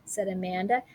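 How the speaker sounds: usual speaking voice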